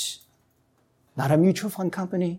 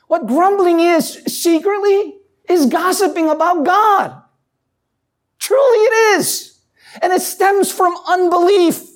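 raised, high and loud, pressing the point; fervent preaching; 10 semitones higher, 4 dB louder than usual. Acted. Pastor warning the congregation about grumbling, fervent preaching.

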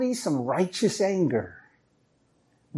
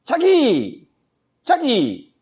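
calling out; 5 semitones higher, slower than usual. Retold or imitated. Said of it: "I'm calling Ellen".

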